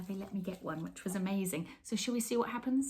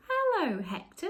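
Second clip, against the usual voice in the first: delighted greeting; 11 semitones higher, 6 dB louder than usual. Acted. Girl greets the cat.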